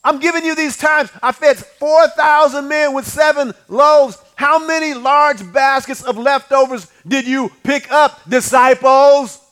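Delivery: slow, loud, every word hammered; slow preaching climax. Acted. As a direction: drive it home slowly and loud.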